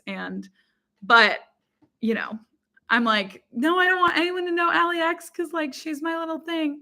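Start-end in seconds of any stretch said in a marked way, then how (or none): 3.5-6.8 high, playful gatekeeping protest; high-pitched mock objection; mock possessive protest.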